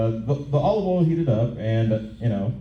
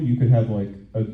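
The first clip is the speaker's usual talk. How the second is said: normal talking voice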